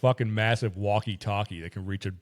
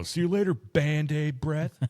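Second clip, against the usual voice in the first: higher, very slow, drawn-out taunt; movie one-liner; 5 semitones higher, slower than usual. Retold or imitated. Kid taunting the unravelled mummy, slow drawn-out high quip. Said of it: "you hear someone go".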